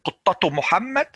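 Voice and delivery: Qittatu with the tu sounded into Muhammad; ta pronounced without a stop; connected form. Shown as a demonstration. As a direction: keep going, Qittatu Muhammad. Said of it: "I'm not stopping, I'm continuing".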